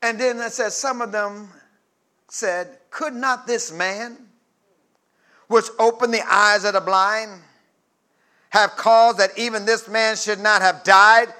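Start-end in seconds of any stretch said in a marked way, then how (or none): 2.9-11.3 rising challenge. Acted. Preacher voices doubters rising.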